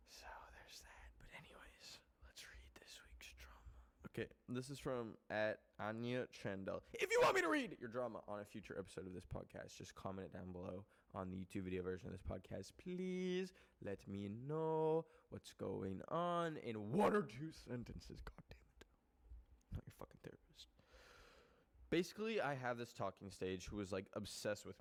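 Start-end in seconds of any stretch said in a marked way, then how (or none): 0.1-3.7 soft whispered aside; whispering. Real, not acted. Quiet aside before reading. 18.2-20.7 whispered grumble; whispering. Real, not acted. Muttered aside at request.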